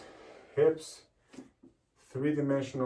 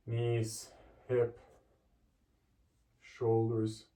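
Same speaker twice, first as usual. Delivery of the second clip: ordinary talk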